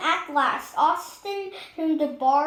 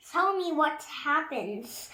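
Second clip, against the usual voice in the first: ordinary delivery, nothing marked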